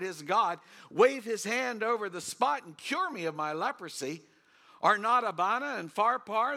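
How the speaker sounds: rising wounded pride, rivers of Damascus; angry complaint. Acted. Naaman turned away by the prophet, loud angry rant. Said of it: "Naaman went away angry and said".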